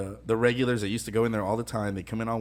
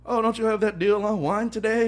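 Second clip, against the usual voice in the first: high, loud, breezy mock question; perky customer impression; 11 semitones higher, 7 dB louder than usual. Waiter imitating customer asking about wine deal, high and chirpy. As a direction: pitch it way up, imitating an oblivious customer.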